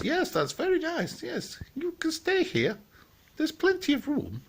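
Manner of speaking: very high falsetto piglet, welcoming; high-pitched agreeable piggy; second little piggy. Acted. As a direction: squeak it in a tiny high pig voice. Second pig welcoming his friend.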